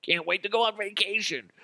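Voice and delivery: high-pitched mock actor aside; rehearsing CEO. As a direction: pipe it up in a put-on voice. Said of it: "him rehearsing that whole thing".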